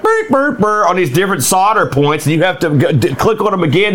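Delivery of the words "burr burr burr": high, buzzing electronic burr; imitated machine beeps. Host imitating the puzzle's circuit sound, high mock beeps. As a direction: mimic the circuit board chirping. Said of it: "it'll go like".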